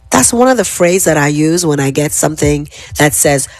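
ordinary talk, nothing marked

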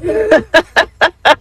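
high, loud peals of laughter; real cackling laugh; helpless laughter. Real, not acted. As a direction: burst out laughing in a high pitch. Cracking up at grandson's words.

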